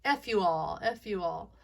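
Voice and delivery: defiant and bratty; lightly voiced judge telling everyone off; mocking judge voice. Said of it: "I hate that voice".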